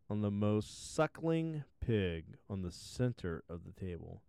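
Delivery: ordinary delivery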